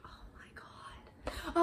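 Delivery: slow, whispered disbelief; hushed mock dismay, drawn out. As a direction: whisper it slowly, acting out dismay. Character's dismayed reaction in recap.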